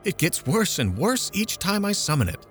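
mother lamenting to a friend, self-pitying; aggrieved lament. A narrator's reading. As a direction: complain as the unluckiest woman alive. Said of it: "she exclaimed".

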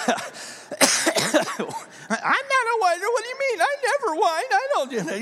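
high-pitched, whiny mock protest; whiner. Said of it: "sitting beside someone who's a whiner".

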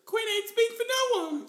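mock Queen. Voicing the Queen.

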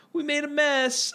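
high, slow, babyish mock apology; baby talk. Old lady baby-talking after spilling lemonade.

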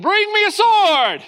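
booming, high, drawn-out mock-royal shout; mock command shout. Chaperone jokingly playing Solomon over a water bottle, mock command shout. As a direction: bellow it like a hammed-up king.

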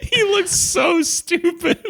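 high, slow squeal of laughter; can barely say it for laughing; real helpless giggling through the words. Real, not acted. Cracking up at the actor.

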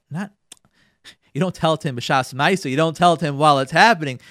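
ordinary talk, nothing marked

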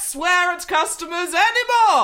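mock royal decree. The car king decrees to the rude clerk, pompously.

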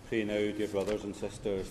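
ordinary talk

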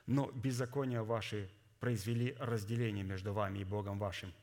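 ordinary delivery

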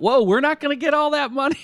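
high, loud mock alarm; mock shareholder alarm. Podcast host mimics shareholders losing payout, high mock alarm. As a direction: squeal it like a shocked shareholder. Shareholders realising they lose money.

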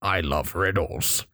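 low, slow creepy riddler; creepy frog man. Acted. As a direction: drawl it like a sly creature.

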